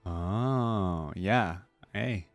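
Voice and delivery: ordinary talk, nothing marked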